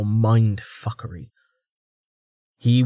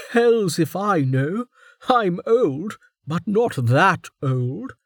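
high, slow dry humour; amused warden; 9 semitones higher, slower than usual. A narrator's reading. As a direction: shrug it off wryly.

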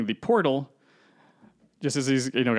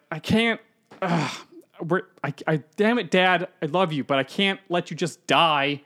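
high, louder, broken words; choked-up refusal; 5 semitones higher, 4 dB louder than usual. Retold or imitated. Son refusing to let his father die, choked and broken. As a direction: force it out through the pain.

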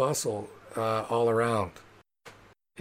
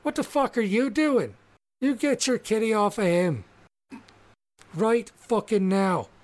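furious halfling woman; 9 semitones higher than usual. Acted. Blonde halfling woman yelling at the party to release her man, furious shout. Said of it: "She goes".

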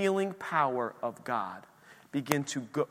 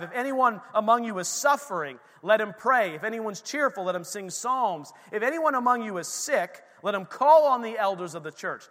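raised, ringing scripture reading; preaching scripture; 6 semitones higher, 6 dB louder than usual. Acted. Preacher reading James aloud to the church, ringing and raised. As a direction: proclaim the verse to the congregation.

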